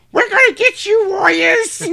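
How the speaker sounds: very high, tiny squeaky voice; high-pitched pipsqueak taunt. Retold or imitated. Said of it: "Totally not the voice you would expect".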